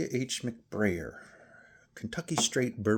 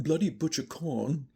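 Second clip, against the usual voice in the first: put-on English accent, pitched higher; higher-pitched imitation English accent; 6 semitones higher than usual. Shown as a demonstration. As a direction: say it in a playful mock English accent. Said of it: "say that with an English accent".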